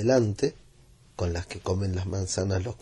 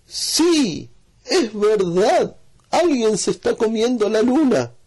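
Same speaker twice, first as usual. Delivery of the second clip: put-on far higher, louder, slow child's voice; excited agreement; 11 semitones higher, 7 dB louder, slower than usual. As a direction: agree in breathless wonder. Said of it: "Y dijo".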